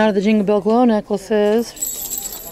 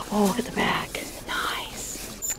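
soft admiring whisper; 10 dB quieter, slower than usual. Real, not acted. Shopper whispering about the jacket back. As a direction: murmur it under your breath.

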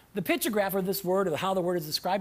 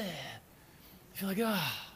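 deflated sigh; 5 semitones lower, 12 dB quieter, much slower than usual. Retold or imitated.

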